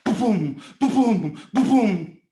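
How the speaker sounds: loud thumping boom, boom; loud heartbeat boom. Shown as a demonstration. Father's heart bursting, loud thumping boom. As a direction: heart exploding with love.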